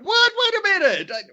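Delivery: imitating his own startled reaction, high and fast at the end; retold shocked disbelief. Telling co-host how he reacted to the news, high shocked voice. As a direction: pitch jumps way up in stunned disbelief.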